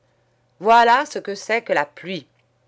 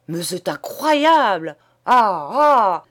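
loud, raised outraged tirade; furious; 4 semitones higher, 7 dB louder than usual. Acted. Priest raging about the naked man on his church, furious. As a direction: spit the words out in scandalized fury. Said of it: "s'empourprait de colère, râpait les mots".

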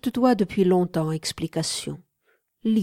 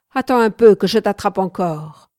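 shouted threat; 8 dB louder than usual. A narrator's reading. Man calling after the fleeing young woman, shouted threat. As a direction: yell a warning after someone, easing off at the end. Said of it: "lui cria-t-il".

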